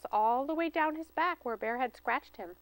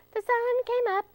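very high, bright chirp; chirping squirrel; 12 semitones higher, 4 dB louder than usual. Acted. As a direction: chirp it out happily. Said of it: "he chirped".

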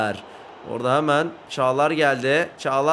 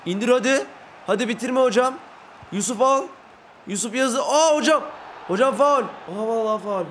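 excited urging; 5 semitones higher than usual. Real, not acted. Urging then protesting foul.

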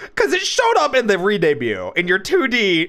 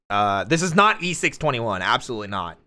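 mock ad read; 7 semitones lower, faster than usual. Low mock ad pitch for E621.

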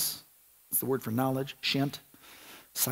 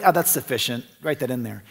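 offhand God; 7 semitones lower, 6 dB quieter than usual. Retold or imitated. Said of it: "This wasn't just God saying".

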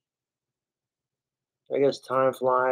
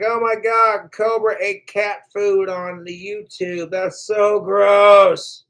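mocking troll voice; 6 semitones higher, 4 dB louder, slower than usual.

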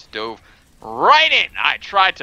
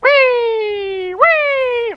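long, very high "wee"; gleeful squeal; 6 dB louder than usual. Pretend. Hamster rolling along in game, gleeful high squeal.

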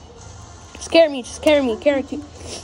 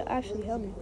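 usual speaking voice

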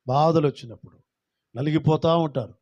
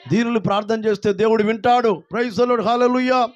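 higher and louder praise shout; loud call-out; 7 semitones higher, 5 dB louder than usual. Shown as a demonstration. Preacher to congregation on request, loud praise. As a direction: lead the crowd loudly. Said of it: "గట్టిగా చెప్పండి".

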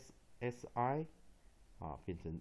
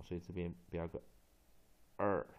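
normal talking voice

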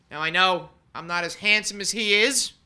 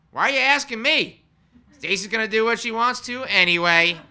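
quoting John; 5 semitones higher, 8 dB louder than usual. Retold or imitated. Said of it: "in typical John fashion".

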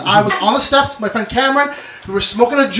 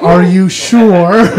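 shocked disbelief; 5 semitones lower, 4 dB louder, much slower than usual. Retold or imitated. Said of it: "And I was so shocked".

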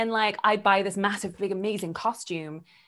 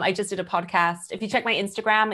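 ordinary delivery

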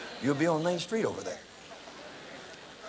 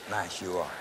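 mock syrupy evangelist flattery, lower; exaggerated nice; 10 semitones lower, 4 dB quieter than usual. Evangelist flattering the congregation, mock nice. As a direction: lay the niceness on thick, tongue in cheek. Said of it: "Exaggerated nice".

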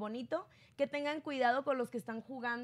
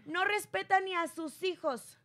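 mild mock yell; 5 semitones higher, 4 dB louder, slower than usual. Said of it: "Y Adame le grita".

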